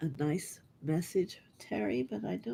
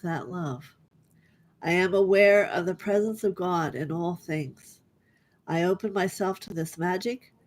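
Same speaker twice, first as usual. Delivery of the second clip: louder, declaiming an affirmation card; affirmation reading; 6 dB louder than usual. Acted. Healer reading a closing card to the group, louder and declaiming. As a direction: proclaim each line like a blessing.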